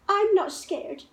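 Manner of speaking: high, defiant little voice; bold child. A narrator's reading. Gruffalo's child insisting to herself, high and defiant. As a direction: insist you're brave. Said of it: "said the Gruffalo's child".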